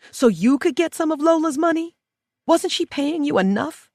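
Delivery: shrill outrage at the greedy visitor; outraged. A narrator's reading. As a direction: fling the question at her. Prisoner accuses her visitor of greed.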